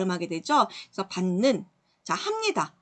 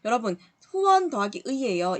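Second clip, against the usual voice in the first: normal talking voice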